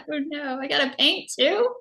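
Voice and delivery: giggling, pretend-horrified; laughing mock dismay. Retelling her craft class to a friend, laughing. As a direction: laugh through a mock complaint. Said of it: "And I was like".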